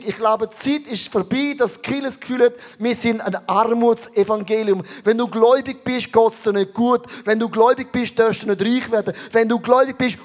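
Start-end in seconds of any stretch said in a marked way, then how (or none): none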